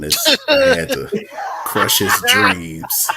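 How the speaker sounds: slow, loud words through laughter; laughing tease. Real, not acted. Teasing a friend over a failed proposal, laughing. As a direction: crack up while drawing out the punchline.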